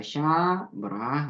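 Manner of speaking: long "샤", short break, then "뭐라"; careful two-part "샤, 뭐라"; separated pronunciation. Shown as a demonstration. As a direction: divide the word into "샤" and "뭐라". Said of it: "분리해서 발음하세요".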